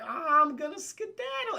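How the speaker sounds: high-pitched comic little monster; put-on cartoonish escape voice; sneaky creature voice. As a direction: squeak it like a critter slipping away. Voices severed head creeping away.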